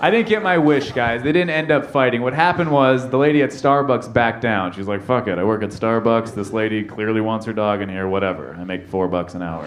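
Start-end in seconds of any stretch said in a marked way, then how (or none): 5.0-9.6 low, soft dismissive grumble; resigned. Acted. Starbucks worker backing down from the argument, resigned. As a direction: give up and stop caring, voice sinking.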